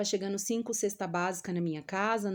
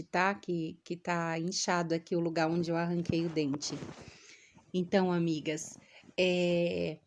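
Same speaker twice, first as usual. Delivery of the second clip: ordinary talk, nothing marked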